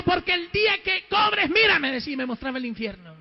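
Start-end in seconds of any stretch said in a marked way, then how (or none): 0.0-1.8 preacher shouting God's warning; retold stern warning. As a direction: drive the warning home loudly.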